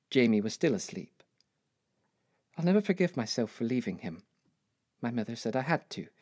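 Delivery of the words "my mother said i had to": normal talking voice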